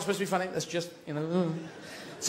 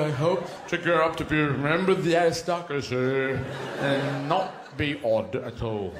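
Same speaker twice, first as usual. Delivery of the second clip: lightly voiced posh boy, laughing through it; a prim little aristocrat thanking his father, laughing; mock posh child. Young aristocrat thanks his father for the blooding, mock prim.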